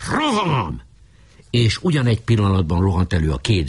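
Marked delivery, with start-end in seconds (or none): 0.1-0.8 high, stretched battle call; shouted charge. A narrator's reading. Boka calling into the hut to attack, high cry. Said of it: "bekiáltott".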